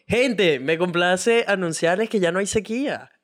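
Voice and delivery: a playful press-release tone; mock announcement. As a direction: announce it grandly to the crowd.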